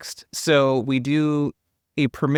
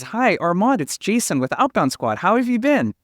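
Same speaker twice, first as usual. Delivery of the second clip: higher, sing-song fake-friendly phone greeting; customer service voice; 7 semitones higher than usual. Shown as a demonstration. Sales rep showing the voice reps put on. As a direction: transform into a chipper service rep. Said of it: "this customer service voice that you use".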